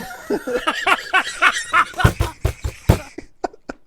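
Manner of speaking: long, high, loud laughing fit; real burst of loud cackling; helpless laughter. Real, not acted. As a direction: crack up completely. Cracks up at botched intro.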